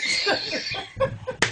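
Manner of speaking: a sudden high, airless burst of laughter; high breathy laugh. Real, not acted. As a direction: laugh up high at your own story. Laughing at his own retold story.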